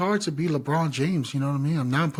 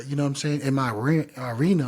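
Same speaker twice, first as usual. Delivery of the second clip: ordinary delivery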